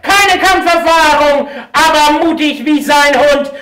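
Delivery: drawn-out booming ring announcer; lucha libre announcer. Acted. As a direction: stretch every name like a big fight intro. Ring announcer introduces fighters.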